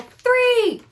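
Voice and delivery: high, loud shout. Shown as a demonstration. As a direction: shout it out big. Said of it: "So I would go".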